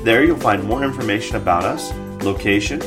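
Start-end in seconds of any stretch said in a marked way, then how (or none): none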